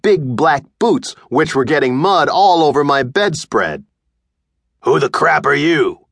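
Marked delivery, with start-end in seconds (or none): 4.8-6.0 a lightly voiced rude stranger firing back; a hint of a tough biker answering the boy; gruff intruder. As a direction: toss it back without looking up. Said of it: "he said back".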